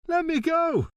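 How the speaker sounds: faked outrage for the staff to hear; bawled. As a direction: bawl as if fighting off an attacker. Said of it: "he bawled".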